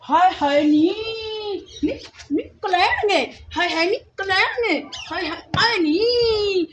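drawn-out hammed-up lament, slow; mock wailing. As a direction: wail theatrically like an aggrieved aunt.

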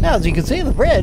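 high, nasal nerdy narrator. Retold or imitated. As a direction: mimic the nerdiest narrator you know. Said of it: "That is the nerdiest voice".

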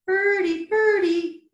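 high, singsong two-note bird call; bird-call whistle. A narrator's reading. Mole's whistle calling like a bird, high singsong. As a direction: pipe it high, falling on each word. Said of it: "it called".

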